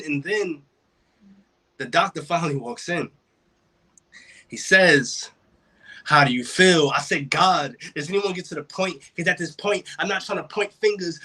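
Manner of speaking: rhythmic recital, rising in pitch as it heats up; spoken-word poem. Acted. Poem builds to hospital argument.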